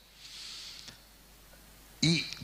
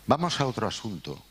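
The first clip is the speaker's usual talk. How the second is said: a dry Valladolid-accent "Vamos a otro asunto", pitched higher like a notary's closing line; 5 semitones higher than usual. Shown as a demonstration. El director imita el acento de Valladolid, frase de notario. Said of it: "con acento de Valladolid".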